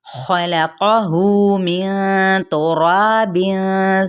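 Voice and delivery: very slow, melodic drawn-out recitation; Quran recitation. Acted. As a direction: chant the verse slowly and reverently.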